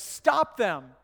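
loud, urgent command, imitating Paul; retold yell. Preacher voices Paul ordering the lifeboats stopped, yelling. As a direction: call it out forcefully, as if to halt men. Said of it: "And Paul yells".

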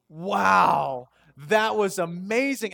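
loud, drawn-out awestruck wow; wide-eyed mock wonder; acted amazement. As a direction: marvel loudly, as if replaying your amazement. Acts out his amazed reaction.

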